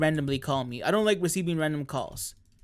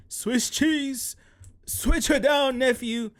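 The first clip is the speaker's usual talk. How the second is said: quoted catchphrase voice; 8 semitones higher, much slower than usual. Retold or imitated. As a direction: belt out the meme line. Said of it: "that reference".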